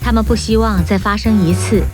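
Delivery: ordinary delivery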